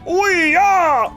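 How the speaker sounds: high loud whooping yeah; excited cheer. Real, not acted. Celebrating the pregnancy.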